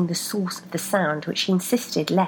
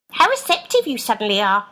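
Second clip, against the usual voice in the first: piped up, higher and louder mock; sarcastic; 4 semitones higher, 7 dB louder than usual. A narrator's reading. Left mocking Rebecca, sarcastic piping voice. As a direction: drip with sarcasm. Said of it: "piped up Left sarcastically".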